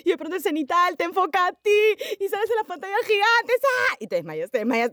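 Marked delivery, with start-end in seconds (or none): 0.0-3.5 high, fast, near-crying excitement; breathless excitement. Acted. Fan overwhelmed, spotlight on her. 3.6-4.0 a piercing high squeal; high excited squeal. Acted. Spotlight moment squeal.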